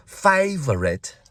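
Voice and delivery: high, slow, soft London English impression; London accent. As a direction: imitate her London "favourite", drawn out high. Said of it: "the way she says".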